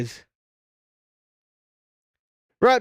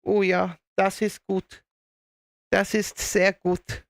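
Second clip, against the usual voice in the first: put-on measured German accent; slow, approving German delivery; Werner Herzog; 3 semitones higher, slower than usual. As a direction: savour the German words slowly. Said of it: "But yes, Werner Herzog was a great actor".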